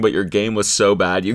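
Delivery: usual speaking voice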